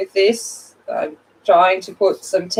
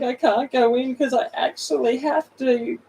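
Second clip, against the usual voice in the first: mock whiny excuse; 7 semitones higher than usual. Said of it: "I'm like".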